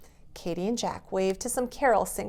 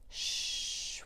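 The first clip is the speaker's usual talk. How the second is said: soft hushing shh; Father Christmas shushing to keep the dogs asleep; 10 dB quieter than usual. Acted. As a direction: shush the room. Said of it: "whispered Father Christmas".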